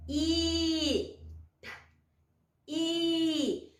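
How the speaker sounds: I held long and steady; letter sound demo. Shown as a demonstration. Teacher showing each letter's sound, sounded out. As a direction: sustain each I separately. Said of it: "o sonzinho de cada uma".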